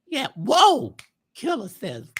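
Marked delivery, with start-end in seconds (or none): 0.3-1.0 high, loud sassy whoa; Geraldine whoa. Retold or imitated. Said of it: "I do all those voices".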